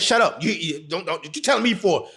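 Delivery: normal talking voice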